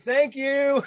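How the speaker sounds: high, breezy retold thank-you; mock-cheery thanks. Gory-made-up customer to staring Wendy's girl, innocently cheery. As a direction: act as if nothing is wrong, pitch lifted.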